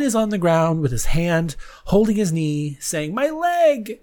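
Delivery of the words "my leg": high-pitched mock cry of pain; acted wail from an injured cartoon character; cartoon figure in pain. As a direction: yelp it like a hurt stick figure. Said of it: "there are three stick figures".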